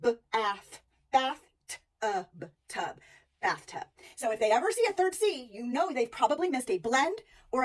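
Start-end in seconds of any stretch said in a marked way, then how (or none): 0.0-3.9 sounding out. Shown as a demonstration. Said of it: "gonna say uh".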